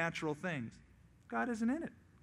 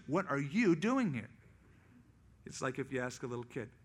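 ordinary delivery

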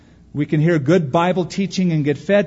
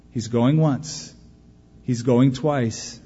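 slow solemn echo; slower than usual. Acted. Preacher echoes auction call, slowly.

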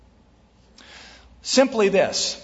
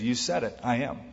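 softer, lower reply; quietly answering Jesus; 4 semitones lower, 7 dB quieter, faster than usual. Acted. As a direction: answer quietly and low, without pushing. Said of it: "And Jesus said".

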